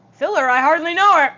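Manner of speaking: loud, high joke delivery; shouted punchline. Acted. Streamer yells a silly pun to her viewers, shouted punchline. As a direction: belt out the punchline.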